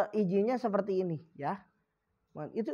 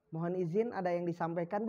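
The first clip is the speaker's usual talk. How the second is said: softer, lower courteous tone; polite request; 4 semitones lower, 6 dB quieter than usual. Shown as a demonstration. Teacher models asking leave in a meeting, polite and soft. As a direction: humbly ask permission to speak. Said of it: "kalau yang lebih sopan begini".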